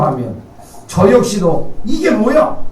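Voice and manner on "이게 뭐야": high, indignant mock grumble; self-mocking what-is-this; mock indignant huff. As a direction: play at a sulky protest, pitched high. Preacher mimics his own grumbling.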